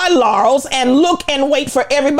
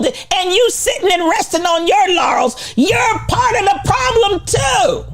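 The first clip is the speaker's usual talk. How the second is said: preaching shout; 8 semitones higher than usual. Real, not acted. Rebuking the congregation.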